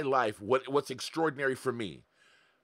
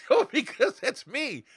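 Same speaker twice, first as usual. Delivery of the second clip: usual speaking voice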